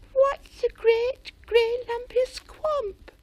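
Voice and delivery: high, slow scared mouse question; frightened squeak. A narrator's reading. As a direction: ask it trembling with fear.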